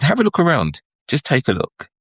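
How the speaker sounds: a hint of a Caribbean driver, calm and knowing; Caribbean accent. Taxi driver telling the tourist to look around, light Caribbean accent. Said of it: "he had a Caribbean accent".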